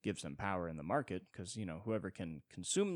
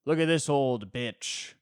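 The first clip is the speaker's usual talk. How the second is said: jeering guy, louder; 6 semitones higher, 11 dB louder, slower than usual. Retold or imitated. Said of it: "some guy's like".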